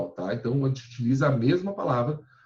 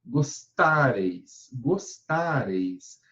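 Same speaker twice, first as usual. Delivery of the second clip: "gostareis" drawn out, weight on "reis"; slow stressed demo; much slower than usual. Shown as a demonstration. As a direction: slowly show where "gostareis" is stressed.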